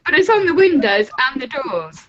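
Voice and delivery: high laughing exclaim. Real, not acted. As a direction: laugh it out loudly. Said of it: "I know it looks weird".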